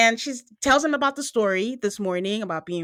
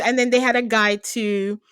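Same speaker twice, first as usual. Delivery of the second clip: normal talking voice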